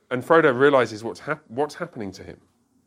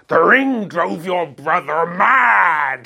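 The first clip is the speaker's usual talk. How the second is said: put-on slow, louder try at Sam's rustic English accent; attempted Sam's accent; 5 semitones higher, 8 dB louder, much slower than usual. Teacher quoting Sam to Faramir, slow in Sam's accent. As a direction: drag out each word in a Sam accent. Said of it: "I can't do the accent".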